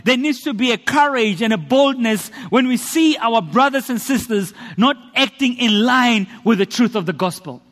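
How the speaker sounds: ordinary talk, nothing marked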